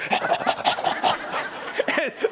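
high breathy mock laugh; mocking dog laugh. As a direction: laugh at them as the dog would. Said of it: "And your dog's like".

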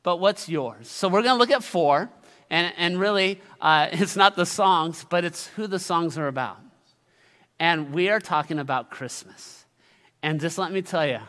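usual speaking voice

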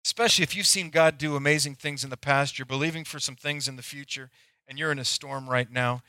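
usual speaking voice

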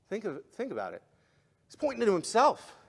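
usual speaking voice

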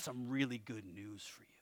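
"I got some really good news for you" whispered close; whispered secret. Pretend. God confides good news to the congregation, whispering. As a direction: whisper it like a secret you can't wait to share. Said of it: "He's saying".

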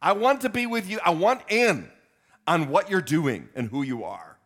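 high, loud eager plea; begging to be washed all over, rising; eager Peter. Retold or imitated. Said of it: "Peter goes".

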